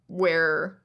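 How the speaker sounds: ordinary talk, nothing marked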